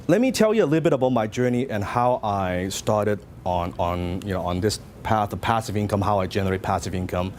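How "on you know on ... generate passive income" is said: usual speaking voice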